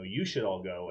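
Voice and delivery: ordinary talk, nothing marked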